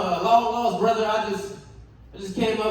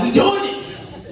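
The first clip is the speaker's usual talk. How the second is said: pep talk; 12 semitones higher, slower than usual. Pretend. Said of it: "I was like, gave my dick a pep talk".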